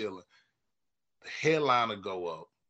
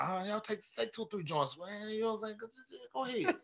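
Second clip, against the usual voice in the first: homie voice; 9 semitones higher, 6 dB quieter than usual. Retold or imitated. Voicing the homie giving joints.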